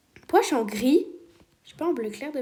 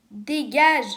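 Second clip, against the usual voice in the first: shout; 3 semitones higher, 7 dB louder than usual. Real, not acted. Chasing a thief from her base.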